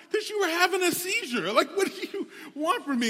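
very high, loud exasperated retort; frustrated outburst. Retold or imitated. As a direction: re-enact losing patience, voice leaping way up. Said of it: "so frustrated".

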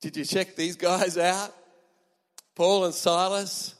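lightly voiced God, slow on the names; an impressed heavenly aside; a hint of a delighted God; God talking to angels. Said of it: "He's getting the angels saying".

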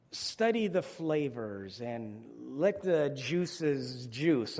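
slow, savoring delivery. Shown as a demonstration. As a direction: linger over every word. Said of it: "chewing it slowly".

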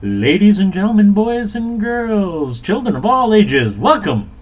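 booming high ringmaster call. Acted. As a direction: announce it like a circus ringmaster. Opens podcast like circus.

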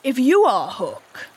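slow, ingratiating appeal; almost humble. A narrator's reading. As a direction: coax the stranger, almost humbly. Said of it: "he said almost humbly".

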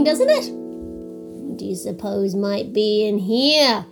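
ordinary delivery

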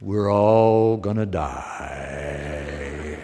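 very low, very slow, soft, gloomy moan; gloomy Eeyore. Retold or imitated. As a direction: droop it out like a hopeless donkey.

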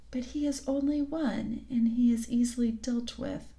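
soft menacing whisper to herself. A narrator's reading. The Queen plots to herself in a whisper. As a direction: whisper the threat under breath. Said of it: "speaking more to herself than to him".

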